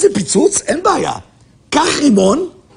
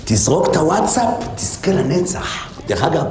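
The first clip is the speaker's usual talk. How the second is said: ordinary talk, nothing marked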